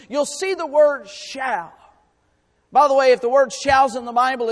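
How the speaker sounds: rising preacher's voice, pressing each shall; preaching. Acted. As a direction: preach it with conviction to the congregation. Preacher builds emphasis on shall.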